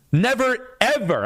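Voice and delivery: NEVER thrown up high, then ever; emphatic never ever. Real, not acted. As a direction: hit Never hard and high, ever after it. Angry call-out at Ben Mula.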